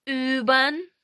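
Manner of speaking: "Üben" loud, with long rounded ü; German ü demo. Shown as a demonstration. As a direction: model "Üben" for learners, rising. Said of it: "Потренуємося на словах".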